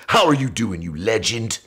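put-on a lightly done English accent, upbeat greeting; imitating a British accent. Friend greeting him on the phone in a mock British accent. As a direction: greet him like a cheery Liverpool bloke, mimicked. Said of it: "that accent".

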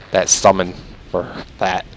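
usual speaking voice

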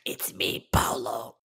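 put-on breathy ghostly whisper of a child; whispered creepy kid.

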